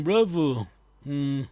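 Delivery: ordinary delivery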